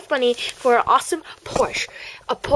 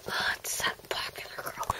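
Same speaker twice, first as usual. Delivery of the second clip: whispered aside; 14 dB quieter than usual. Acted. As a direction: whisper under breath.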